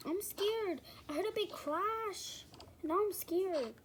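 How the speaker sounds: high whisper, a little scared. Acted. Daughter whispering her fear to mom, high whisper. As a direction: whisper up high like a frightened kid.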